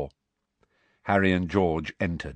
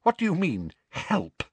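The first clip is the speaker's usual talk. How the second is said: constricted voice; 9 semitones higher than usual. A narrator's reading. Professor questioning intruder's offer, tightly constricted. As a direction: hold back anger through a constricted throat. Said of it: "in a constricted voice".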